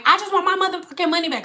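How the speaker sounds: high, fast mock yell without much volume; retold yelling. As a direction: imitate an irate customer's outburst. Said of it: "she was yelling at me".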